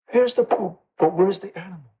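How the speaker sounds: lightly imitated British accent, pitched high; a hint of British gentleman's puzzled wonder. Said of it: "with his British accent".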